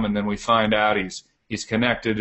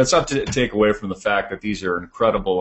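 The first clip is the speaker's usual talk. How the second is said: ordinary talk, nothing marked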